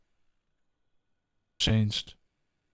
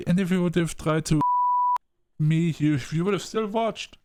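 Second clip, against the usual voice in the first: higher and louder second try; angry restart; 6 semitones higher, 4 dB louder than usual. Shown as a demonstration. Player restarting the accusation at Frank, louder and angrier. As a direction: restart it with force. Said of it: "well, I'm gonna say it this way".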